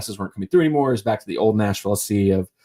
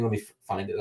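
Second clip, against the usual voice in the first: ordinary talk, nothing marked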